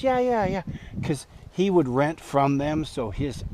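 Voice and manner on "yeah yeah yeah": high, eager yeah-yeah-yeah; imitating an excited buddy; eager yes. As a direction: toss it off, keen and quick. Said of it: "He goes".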